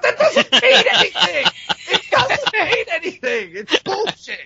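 high, laughing scoff; laughing disbelief. Real, not acted. Laughing through mock outrage rant.

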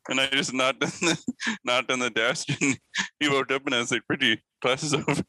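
words broken up by giggles; laughing while telling. Real, not acted. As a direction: try to get the story out through laughter. Cracking up telling the story.

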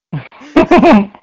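loud burst of laughter; real loud laugh. Real, not acted. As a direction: laugh hard at the punchline.

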